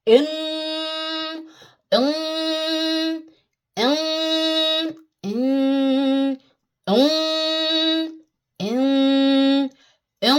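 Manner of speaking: इम hummed heavy and full through the nose; full-mouth nasal इम, held long; full-mouth ghunna. Shown as a demonstration. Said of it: "हमें full mouth करना है".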